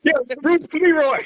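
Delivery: high-pitched shout-out through laughter; laughing tease. Real, not acted. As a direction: crack up on the nickname, pitch way up. Group cracking up over a nickname.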